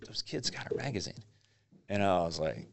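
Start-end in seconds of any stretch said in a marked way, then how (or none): 0.1-1.2 retold whisper. Friend pointing out kids with their magazine, imitated whisper. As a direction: imitate a friend's hushed tip-off.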